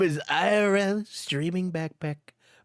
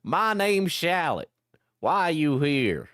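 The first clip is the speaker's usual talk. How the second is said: lightly voiced country hillbilly accent; good old country voice; 2 semitones lower than usual. Shallot greets the captive in a light country accent. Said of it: "I should have been doing like a good old country voice".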